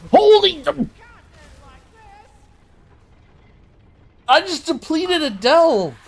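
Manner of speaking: high, loud outraged yell; frustrated yell. Real, not acted. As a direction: yell it in disbelief, voice cracking high. Delve fails, streamer yells.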